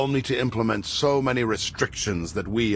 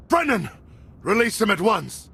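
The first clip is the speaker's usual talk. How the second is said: frantic shouting; 12 semitones higher than usual. Acted. Son held hostage, father cries out.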